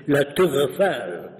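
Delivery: slow, put-on French pronunciation; French accent. Shown as a demonstration. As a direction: say it slowly as a French native would. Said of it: "If he's brought up in France".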